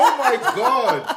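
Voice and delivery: high, slow, laughing groan of disbelief; laughing exclamation. Real, not acted. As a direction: stretch it out while laughing. Cracks up at being shamed.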